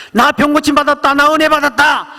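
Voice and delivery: 높은 목소리로 신나게 외치듯; 병 나은 기쁨에 들떠 자랑하듯. Retold or imitated. 아홉 명이 들떠 자랑함. (병 고친 아홉 명이 들떠 자랑하는 높은 외침)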